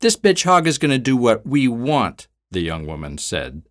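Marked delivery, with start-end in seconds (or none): 0.0-2.3 high, loud gloating sneer; smiling sneer. Acted. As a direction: relish her power over the victim.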